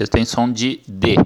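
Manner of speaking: ordinary talk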